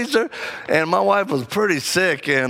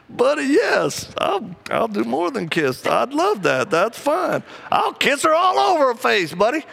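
eager promise; 7 semitones higher than usual. Retold or imitated. Father answering his son, eager and high. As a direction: gush it out like a proud dad.

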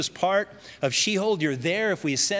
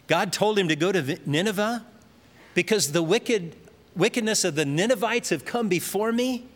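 ordinary delivery

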